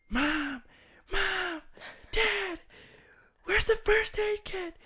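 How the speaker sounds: high panicked scream for help. Retold or imitated. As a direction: shriek for mom and dad. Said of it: "she just starts screaming".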